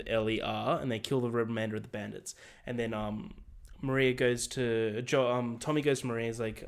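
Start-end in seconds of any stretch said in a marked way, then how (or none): none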